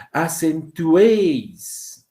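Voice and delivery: "Acentuéis" very slow, loud, stretched on the é; stressed open vowel. Shown as a demonstration. Teacher showing where the voice's force falls, slow stressed é. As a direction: lean long and hard on the é of "Acentuéis". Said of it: "Acá está la mayor fuerza de voz".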